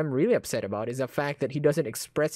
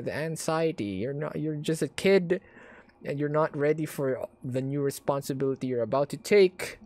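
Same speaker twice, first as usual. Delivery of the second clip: normal talking voice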